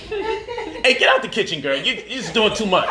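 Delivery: high laughing shoo; laughing scold. Real, not acted. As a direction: chase her out while laughing. Shooing baby from kitchen.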